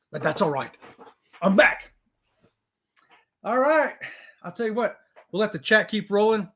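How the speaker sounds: ordinary talk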